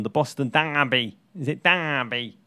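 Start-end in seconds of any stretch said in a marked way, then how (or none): 0.5-1.2 Boston-accented DERBY with a long broad "ah"; attempted Boston accent. Shown as a demonstration. Host guessing how Boston says Derby, Boston accent. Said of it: "how do you say it in Boston". 1.6-2.4 DERBY drawn out slowly in mock Boston, "Daaahby"; exaggerated Boston accent. Shown as a demonstration. Host checking his Boston pronunciation, hammed-up Boston accent. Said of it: "how do you say it in Boston".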